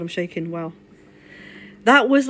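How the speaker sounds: ordinary talk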